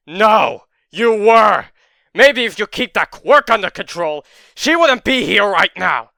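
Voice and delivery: loud, high accusing yell; furious shout. Acted. Izuku screaming blame at Bakugo. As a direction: hurl the blame back at him.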